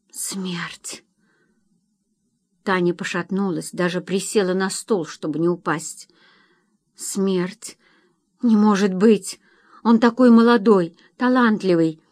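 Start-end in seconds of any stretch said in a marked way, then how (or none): 0.1-1.0 barely voiced, stunned whisper; shocked whisper. A narrator's reading. Wife hearing her husband may die, shocked whisper. 6.9-7.8 hushed, horrified echo; stunned whisper. A narrator's reading. Wife nearly fainting at the doctor's warning, stunned whisper. 8.4-12.0 higher, louder protest, easing at the end; shocked disbelief. A narrator's reading. Wife protesting to doctors that he cannot die, shocked disbelief.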